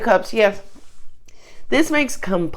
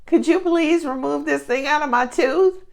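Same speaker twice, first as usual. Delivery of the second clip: higher, whiny lion in pain begging for help; lightly higher pained animal voice; hurting lion; 6 semitones higher than usual. Retold or imitated. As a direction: play a wounded king of the jungle pleading.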